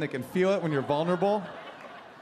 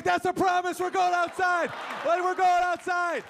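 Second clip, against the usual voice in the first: much higher, shouted glee; ecstatic dog; 11 semitones higher than usual. Pretend. Dog hearing its owner say outside, shouted glee. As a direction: lose it over the word outside.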